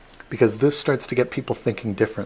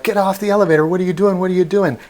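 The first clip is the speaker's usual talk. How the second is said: higher, louder mock yelling; panicked crowd yelling; 8 semitones higher, 6 dB louder, faster than usual. Fairgoers screaming at Otis to get off, higher and louder. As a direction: shout at the man on the platform.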